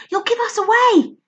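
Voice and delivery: put-on high, tense girl's warning; urgent warning. Lou warning Barney in the tree, tense voice. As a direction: warn him urgently. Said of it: "Lou hissed".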